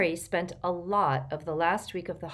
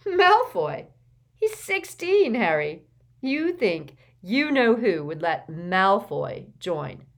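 high, loud scoffing outburst, words drawn out; incredulous laughter; 4 semitones higher, 8 dB louder, slower than usual. A narrator's reading. Ron ridicules Harry's theory about Malfoy, loudly incredulous. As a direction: hoot it in hilarious disbelief. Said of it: "then Ron erupted in laughter".

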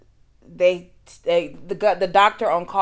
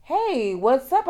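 much higher, a careless cheery hello; breezy casual greeting; 8 semitones higher, slower than usual. Retold or imitated. Careless doctor greets the bleeding patient, breezy. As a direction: toss it off like nothing's wrong. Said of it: "he's like".

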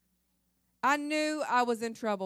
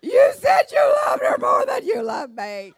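much higher, louder mock wail; hysterical wail; 12 semitones higher, 13 dB louder than usual. Wife wailing to husband about her dream, hammed-up shout. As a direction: shriek it like a frantic dream-shaken wife.

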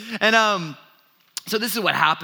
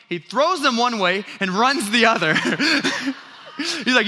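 normal talking voice

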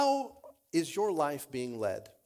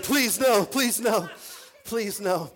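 high, loud imitation pleading; hammed-up high-pitched begging; mock freaking out; 6 semitones higher, 5 dB louder, slower than usual. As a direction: playfully imitate a panicked girl begging off. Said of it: "she's all freaking out already".

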